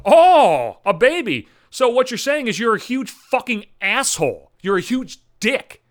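mocking oh-I-see tone; sarcastic mock realization. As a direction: drip sarcasm as if it just clicked. Sarcastic retort in thought.

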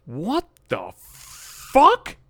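very high, drawn-out outraged squeal; stunned, high-pitched what the fuck; stunned disbelief. Real, not acted. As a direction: stretch it out in shock. Outraged at her reply.

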